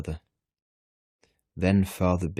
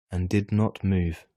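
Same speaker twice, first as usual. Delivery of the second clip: ordinary delivery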